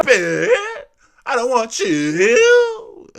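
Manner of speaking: loud mock drawl stretching "you"; slow, loud imitation, "you" pulled long and high; mimicked word endings. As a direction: exaggerate her dragged-out endings on "Stupid" and "you". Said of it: "why is she talking like that".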